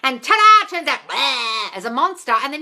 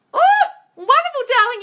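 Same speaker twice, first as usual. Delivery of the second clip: high, loud gushing oh wonderful darling; gushing delight; 11 semitones higher, 6 dB louder than usual. Real, not acted. Coach gushing to a viewer who just saw her ex, loud and high. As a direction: sound thrilled for her.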